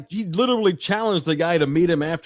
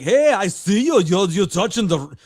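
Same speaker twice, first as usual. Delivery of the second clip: slightly raised, badly faked Swedish accent; 3 semitones higher, 4 dB louder than usual. Swedish curler accusing a rival of touching, bad Swedish accent. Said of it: "I can't do a Swedish accent".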